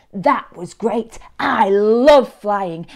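slow, savoured delight; thrilled slug. Acted. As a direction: relish every word after a thrilling ride. Norman thrilled after falling.

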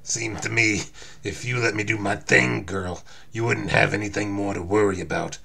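low, loud tough guy; Wolverine, cocky. Acted. Hero promising to free the girl, cocky.

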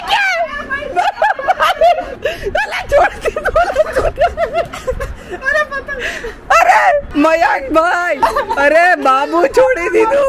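high loud laughing yells; excited shouting. Real, not acted. Yelling at Mayank for letting the kite go, high laughing shouts.

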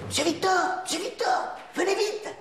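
high, urgent summons; urgent calling. Acted. Assistant calling monsieur Victor to the phone, urgent high call. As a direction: call the boss over in a hurry.

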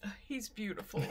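soft, choked with tears; tearful whisper. Real, not acted. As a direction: murmur it through tears. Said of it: "do I have mascara running down my face".

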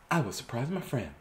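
softly, as a private thought; thinking it. Acted. Piggy thinking to herself, softly voiced thought. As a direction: say it under your breath, to yourself. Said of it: "she was thinking it".

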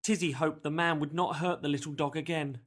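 plain reading voice

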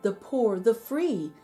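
plain reading voice

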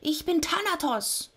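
high, thin creature voice; insistent Death. A narrator's reading. Death introduces himself to mocking Sisyphos, insistent. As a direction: insist on your name, pitched high.

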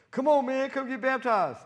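high, loud pleading call; hammed-up loud coaxing; loud urging. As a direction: beg them to come get baptized. Urging someone to get baptized.

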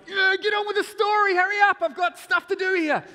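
acted high, very loud shouting; impatient dad. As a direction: hurry the kid along at a yell. Impatient dad interrupts child's story.

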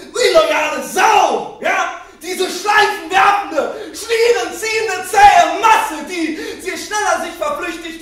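high, loud, furious rant; performed outrage, words stretched and shouted; shouted tirade. Acted. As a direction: hurl the insults at full voice. Insults hurled at time on stage.

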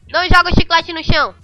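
ordinary talk, nothing marked

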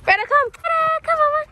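high sing-song call to the dog. Real, not acted. Owner calling her dog, high and coaxing. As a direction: coax the dog over in a high voice.

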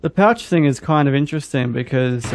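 ordinary delivery

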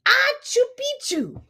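high sneeze-word; half sneeze, half spoken word; sneezed password. Acted. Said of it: "half sneezed, half spoke".

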